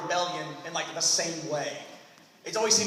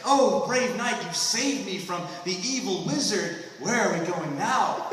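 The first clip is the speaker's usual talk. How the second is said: swooning princess; 4 dB louder than usual. Pretend.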